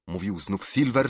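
ordinary delivery, nothing marked

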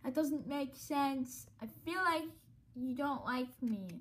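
ordinary talk, nothing marked

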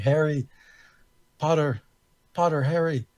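heckling from the sidelines at the goalie, mock yell. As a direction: call it out across the gym like a heckler. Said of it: "he starts yelling out".

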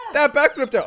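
ordinary talk

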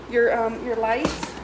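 normal talking voice

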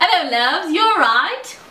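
put-on lilting English-accented greeting, lifted at the end; playful go at an English accent; attempted English accent. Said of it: "That's really good".

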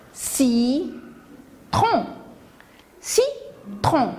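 "citron" split into ci... tron; a clear pause inside "citron"; syllable by syllable. Shown as a demonstration. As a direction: say "citron" in two separate beats, slowly then tighter. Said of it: "Écoutez".

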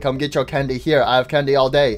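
low, soft, repetitive robot hawker; monotonous candy vendor. Acted. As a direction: drone on selling candy in a low voice. Candy Cadet hawking candy nonstop.

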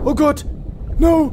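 sudden high yelp of shock; startled cry. Real, not acted. Gamer jolted by a sudden attack, startled high cry. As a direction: caught off guard, cry out.

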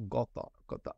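Qatar tried with a throaty, rounded "kol" or "gol" first syllable; Qatar as an outsider's attempt at the local accent; attempted local pronunciation. Shown as a demonstration. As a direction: have a halting go at Qatar the local way, KOL-tar. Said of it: "can't really say it the way they do".

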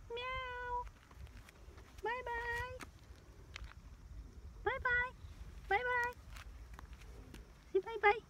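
put-on high, singsong baby voice; baby talk to cat. Saying goodbye to the cat in a high baby voice.

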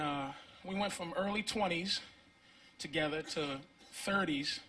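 ordinary talk

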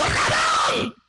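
high, frightened yelp of recognition; acted shriek of surprise; startled cry. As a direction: shout it out as if suddenly startled. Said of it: "Se asustó la señora y dijo".

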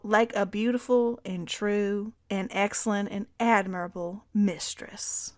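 lightly drawled Southern belle reading; a hint of a Southern accent on the quote. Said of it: "Stop with the Southern accent".

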